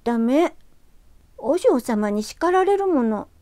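high, slow firm no; firm girl's refusal. Acted. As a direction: refuse primly as a girl. ユキがきっぱり断る. (きっぱり断る少女の声)

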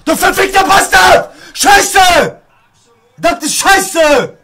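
loud, high-pitched outburst of swearing; angry cursing. Real, not acted. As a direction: curse furiously at the game. Cursing at the game. (wütendes Fluchen)

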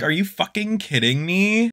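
normal talking voice

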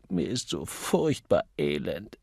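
slow, miserable moan; pleading. A narrator's reading. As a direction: drag it out wretchedly. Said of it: "flehte Mister Copley".

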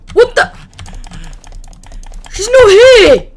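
high, loud startled outburst; shocked yell. Real, not acted. Player yells in shock that the monster vanished. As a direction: yell it in disbelief.

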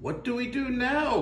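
high, anxious mermaid cry; worried cry. Acted. Mermaid trapped in the whale's belly, crying out high. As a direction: mermaid calling out in alarm. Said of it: "cried the mermaid".

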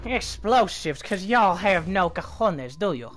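put-on hillbilly accent. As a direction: sneer like a backwoods hillbilly. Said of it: "do my fucking hillbilly accent".

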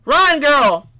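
loud sudden run call; urgent cry. Real, not acted. Player spooked by a noise, yelling at her character to run. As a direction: shout it at your character.

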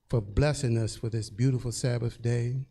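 ordinary talk, nothing marked

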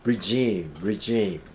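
"regime" said twice, very slow and drawn out; model pronunciation. Shown as a demonstration. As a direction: pronounce "regime" slowly for students to repeat. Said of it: "teacher models new vocabulary". (ออกเสียง "regime" ช้ามาก สองครั้ง)

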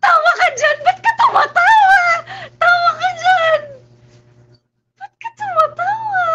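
high loud shouted scolding; mock yelling. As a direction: yell at someone laughing. Repeated yelling at laugher.

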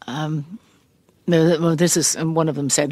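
ordinary delivery

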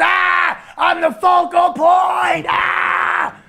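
high, strained, drawn-out yell; slow mock wail framed by "aah" cries; Sabu mocking the cry. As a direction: holler it like a wrestler impression. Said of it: "he's going".

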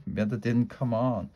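ordinary delivery

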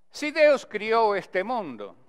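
verse recitation. Shown as a demonstration. Teacher reciting the second décima to the class, slow declaimed verse. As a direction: declaim the cordel line with measured weight. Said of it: "recitando para vocês".

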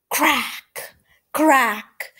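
loud sharp crack; thunder crack. Acted. Thunder sound in story.

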